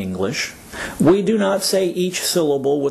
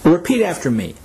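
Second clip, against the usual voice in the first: ordinary delivery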